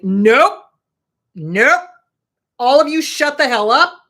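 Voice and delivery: loud, forceful nope, then a shout; shouting the thoughts down. Shown as a demonstration. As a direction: slam the door on the nasty thoughts. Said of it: "you have to literally be like".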